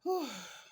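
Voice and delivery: real, high heavy sigh; emotional sigh. Real, not acted. Overcome while talking to viewers about soldiers, emotional sigh. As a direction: let out a sudden emotional sigh. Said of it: "I'm getting emotional".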